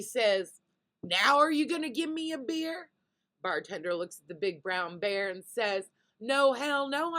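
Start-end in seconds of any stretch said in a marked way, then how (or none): 1.0-2.9 high, loud mock challenge; insistent demand. Bear confronts bartender after eating the lady, demanding. 6.2-7.2 raised, mock-exasperated no; louder, higher acted refusal; emphatic refusal. Bartender turns down the bear's third request, exasperated.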